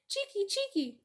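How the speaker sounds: high chirping scold; scolding swallows. A narrator's reading. Swallows swoop down and scold visitors, high chirp.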